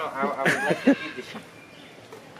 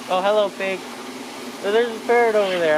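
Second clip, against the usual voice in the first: high and loud with excitement; excited; 7 semitones higher, 6 dB louder than usual. Real, not acted. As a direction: call out in delight. Excited greeting at the zoo.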